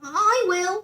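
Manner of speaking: high, loud eager reply; keen bear offering at once. A narrator's reading. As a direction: jump in to help. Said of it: "said Paddington eagerly".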